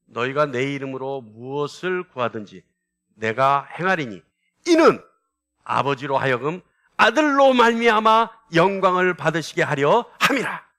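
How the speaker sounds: measured reading building to a loud, emphatic peak; stretched phrases with emphatic bursts; scripture reading. Acted. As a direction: lead the congregation through the verse, swelling as it goes. Leads a scripture reading aloud.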